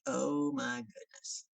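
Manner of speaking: slow, drawn-out "oh my" reaction; acted awe at someone impressive; retold awe. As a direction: stretch it out, mildly overwhelmed. Awed reaction to rival's credentials.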